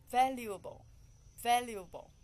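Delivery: "Valuable" with first syllable loud and high; stress on first syllable. Shown as a demonstration. Teacher models "Valuable" for learners, first syllable strongly stressed. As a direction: punch the start of "Valuable" loudly, then fall away. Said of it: "The first".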